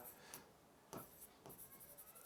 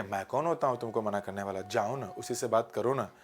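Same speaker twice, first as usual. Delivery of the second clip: a hint of a sulky girlfriend; 4 semitones lower, 7 dB quieter than usual. Teacher imitating how people say it, mild sulk. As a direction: lightly play a jealous girlfriend. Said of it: "ऐसे बोलते हैं ना आप लोग".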